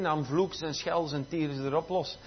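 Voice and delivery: ordinary talk, nothing marked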